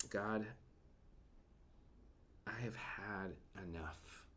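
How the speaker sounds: slow, breathless whisper of a worn-out prophet; hushed, slow plea of giving up; despairing whisper. Retold or imitated. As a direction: whisper it like you have nothing left. Elijah's despairing prayer retold.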